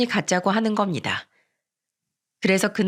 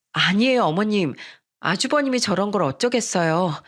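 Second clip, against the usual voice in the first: ordinary delivery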